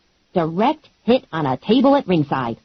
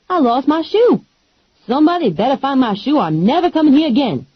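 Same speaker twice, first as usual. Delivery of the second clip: a lightly voiced, higher, sassy friend; sassy Lula; 7 semitones higher than usual. Lula warning the wrestlers, lightly voiced and sassy. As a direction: tell them off with attitude. Lula's voice.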